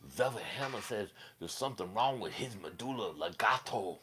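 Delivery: soft, lilting put-on accent; Bad Brad accent. Velvet Hammer diagnosing someone, soft mock accent. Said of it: "That's who Bad Brad sounds like".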